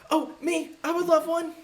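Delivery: put-on very high, eager child voice; little boy. Little boy asking the clown for a balloon, squeaky high. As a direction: pipe up like a small boy. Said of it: "little boy, um, uh, just kinda go".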